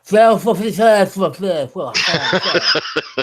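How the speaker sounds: drooling, slurred fake-French babble; slobbery mock-French noises; mock French gibberish. Shown as a demonstration. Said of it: "talk like you're, you're drooling".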